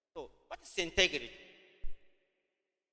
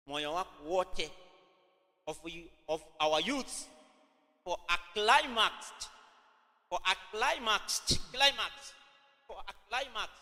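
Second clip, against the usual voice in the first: slow, deliberate dictation pace. Shown as a demonstration. Teacher dictating notes to students, slow and deliberate. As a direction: read it out slowly for notes. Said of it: "I repeat again".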